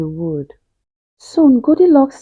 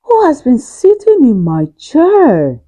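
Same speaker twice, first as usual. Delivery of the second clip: loud Father Bear voice; 4 semitones higher, 5 dB louder than usual. Acted. Father Bear at his big chair, loud. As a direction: make Father Bear boom. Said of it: "he asked in a very loud voice".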